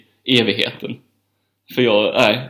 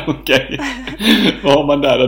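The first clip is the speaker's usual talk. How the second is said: ordinary talk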